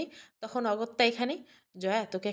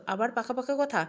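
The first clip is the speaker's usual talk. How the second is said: ordinary delivery, nothing marked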